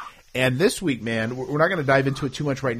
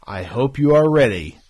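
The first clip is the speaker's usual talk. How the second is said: announcer voice; 4 semitones higher, 8 dB louder, slower than usual. Acted. Announces show like ring announcer.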